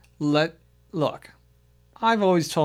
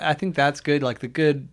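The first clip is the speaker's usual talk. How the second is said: ordinary talk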